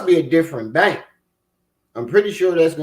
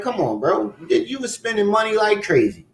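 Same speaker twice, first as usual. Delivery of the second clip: normal talking voice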